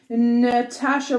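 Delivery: slow, stalling on the first name; lengthened name while working out Romanoff; drawn-out Natasha. Shown as a demonstration. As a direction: stretch out Natasha slowly while finding Romanoff. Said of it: "trying to lengthen out Natasha".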